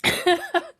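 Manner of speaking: a high laugh through real tears; high teary laugh breaking out; tearful laugh. Real, not acted. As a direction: laugh while starting to cry. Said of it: "Of course I'm crying".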